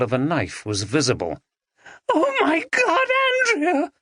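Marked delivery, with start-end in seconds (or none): 2.1-3.9 very high anguished cry. A narrator's reading. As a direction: Maggie cries out over Andrea. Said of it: "cried Maggie".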